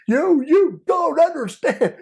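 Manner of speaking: very high, loud, slow wailing plea; imitating wailing sob. Undercover sobbing again to the trafficker, very high loud wail. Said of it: "sobbing undercover repeated".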